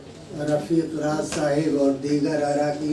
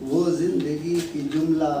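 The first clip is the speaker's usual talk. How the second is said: measured mushaira delivery, lines repeated with more lift; Urdu ghazal recitation; 3 semitones higher, 6 dB louder than usual. Acted. Poet reciting a ghazal to an appreciative gathering, declaimed. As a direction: recite each phrase with weight, pausing for praise.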